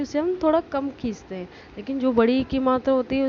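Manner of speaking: ordinary delivery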